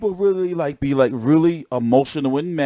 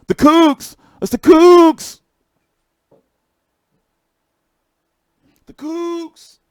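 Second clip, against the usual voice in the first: excited shout; 11 semitones higher, 5 dB louder, much slower than usual. Real, not acted. Excited recognizing someone.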